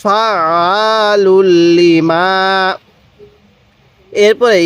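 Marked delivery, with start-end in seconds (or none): 0.0-2.8 each syllable stretched long; slow recitation. Shown as a demonstration. Teacher showing the joined reading slowly. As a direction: recite slowly with held sounds. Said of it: "কিভাবে পড়ব?".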